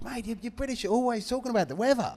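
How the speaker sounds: high, put-on Australian accent; Joe Montemurro. Manager teasing British press about weather, Australian accent. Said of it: "another light moment in the".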